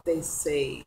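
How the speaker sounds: ordinary talk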